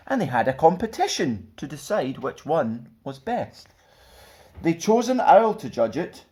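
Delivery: lively, sing-song storybook reading; children's story narration. A narrator's reading. Stuart reading a picture book to children, lively. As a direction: read it like bedtime storytime for kids.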